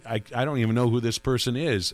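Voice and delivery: ordinary talk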